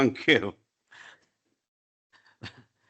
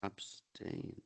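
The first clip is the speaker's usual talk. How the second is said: whispered; 3 semitones lower, 19 dB quieter than usual. Real, not acted. Clerk quietly repeats vote.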